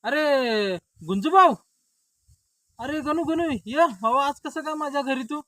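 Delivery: high, loud greeting; hailing a friend. Acted. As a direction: call out to a visiting friend. Friends hail each other at house. (मोठ्या आवाजात हाक मारून स्वागत)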